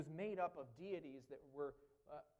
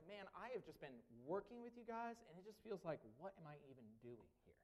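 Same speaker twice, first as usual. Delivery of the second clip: soft, weary, quickening at the end; a tired sigh of a teacher fed up; exasperated; 8 dB quieter than usual. Retold or imitated. Preacher imitating an exasperated Paul, softer and weary. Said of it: "Paul almost sounds exasperated".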